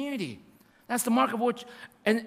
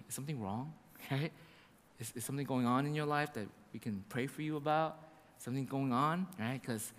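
gentle concern; 8 semitones lower, 8 dB quieter than usual. Retold or imitated. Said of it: "he's like".

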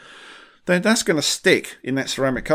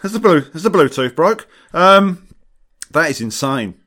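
disbelieving; 6 semitones higher, 8 dB louder than usual. Real, not acted. Reacting to absurd detailer question.

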